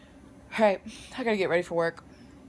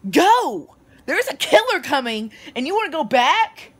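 mock yell; 8 semitones higher, 7 dB louder than usual. Yelling at horror character.

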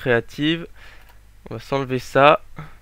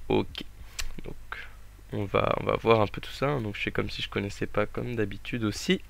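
softer, almost library voice; speaking softly; 3 semitones lower, 8 dB quieter than usual. Real, not acted. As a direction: drop the voice down low. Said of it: "Pourquoi je parle moins fort".

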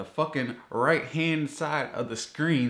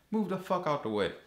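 angry outburst; 8 semitones higher than usual. Real, not acted. Ranting about the ad box.

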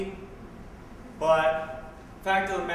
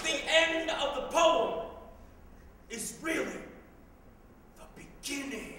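spoken word poem; 10 semitones higher than usual. Acted. Closing line of a performed poem.

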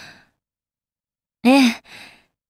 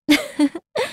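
soft, high little laugh; short, quiet titter; light chuckle; 6 semitones higher, 5 dB quieter than usual. Acted. Character chuckles at her opponent.

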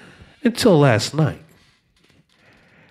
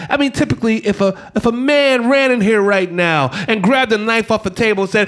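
usual speaking voice

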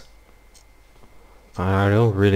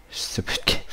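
whispering; 8 dB quieter than usual. Real, not acted. Whispered grumble.

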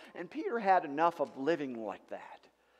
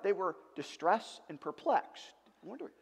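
ordinary delivery

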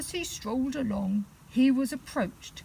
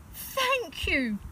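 grateful little bear; 10 semitones higher than usual. Acted.

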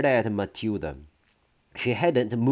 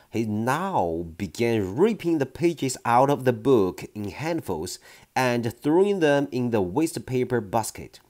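ordinary delivery

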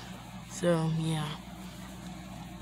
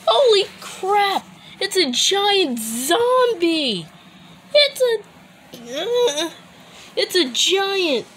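panicked, much higher shout; terrified horror victim; 10 semitones higher, slower than usual. Acted. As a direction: shriek in terror at the giant. Giant zombie appears in game.

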